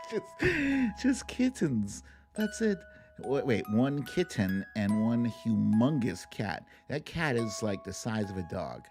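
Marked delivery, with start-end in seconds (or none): none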